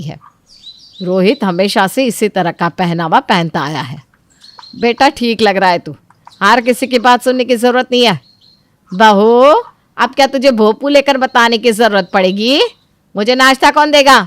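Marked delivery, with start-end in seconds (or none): 4.8-14.2 louder, sharp mother-in-law; bossy mother-in-law. Acted. Mother-in-law backing son and demanding breakfast, bossy. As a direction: snap at the daughter-in-law.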